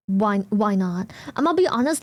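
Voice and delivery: usual speaking voice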